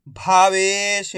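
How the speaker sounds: Bhavesh drawn out very slowly after the syllables; teacher blending Bhavesh slowly for pupils; slowly blended word. Shown as a demonstration. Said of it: "मैं किस तरीके से एक एक अक्षर का उच्चारण करके".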